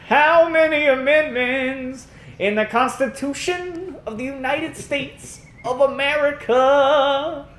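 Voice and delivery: high, slow put-on voice; mock witness voice. Imitating the witness on the stand, high and slow.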